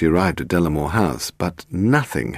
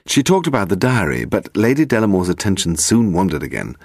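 plain reading voice

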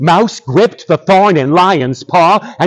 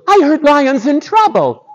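high, small mouse voice; little mouse; 11 semitones higher than usual. Acted. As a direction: speak up as a tiny, earnest mouse. Mouse addresses the animals.

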